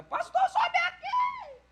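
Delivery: shrill, drawn-out plea from far above; desperate cry. Retold or imitated. Desperate woman pleads with the pastor to come up, slow shrill cry. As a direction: beg him to come up, almost laughing in anguish. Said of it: "E a mulher desesperada".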